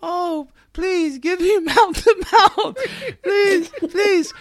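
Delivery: high, loud mock pleading; whiny little child imitation. Actor mocking the actress's mouth-to-mouth scene, childish begging. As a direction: beg like a pestering child, drawn out. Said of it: "acting like a little child".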